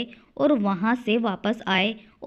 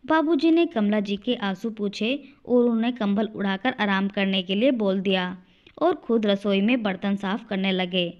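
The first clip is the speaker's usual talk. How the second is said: ordinary delivery, nothing marked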